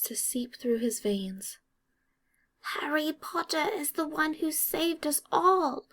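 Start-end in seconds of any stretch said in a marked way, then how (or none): 2.7-5.9 high elf voice, pressing; urgent elf. A narrator's reading. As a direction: plead as the worried elf. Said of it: "Dobby whispers".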